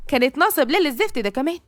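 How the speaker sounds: plain reading voice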